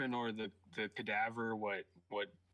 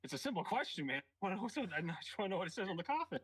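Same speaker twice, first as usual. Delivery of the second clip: exasperated insistence; 7 semitones higher than usual. Acted. Arguing in character with goblin.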